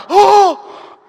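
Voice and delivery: high, loud "oh"; amazed gasp. Retold or imitated. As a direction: gasp as if you've found out the trick. Fan reacts to handler's trick.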